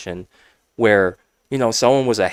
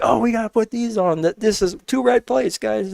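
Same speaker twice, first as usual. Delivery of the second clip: high-pitched imitation of calling out numbers; mock yelling; 12 semitones higher than usual. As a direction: mimic a teammate's yell without real volume. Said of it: "now yelling out the numbers".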